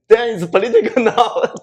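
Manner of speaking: high, loud, laughing quote; laughing mock exclaim. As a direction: laugh out the thought. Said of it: "I think".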